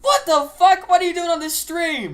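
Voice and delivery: loud, high disbelief; astonished shout. Real, not acted. Astonished at alien guest.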